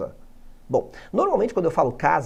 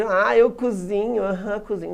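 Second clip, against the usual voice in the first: mock innuendo; 3 semitones higher than usual. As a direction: tease like a friend twisting the words. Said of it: "Você".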